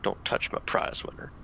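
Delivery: whispered warning under the breath; quiet mutter. Acted. Player guarding the prize pumpkin from onlookers, quietly muttering. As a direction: mutter it almost voicelessly, very soft. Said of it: "just very quietly mutter".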